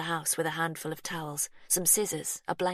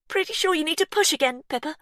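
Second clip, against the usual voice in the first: high, loud and fast; urgent urging; 11 semitones higher, 8 dB louder, faster than usual. A narrator's reading. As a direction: hurry her on. Urging during birth.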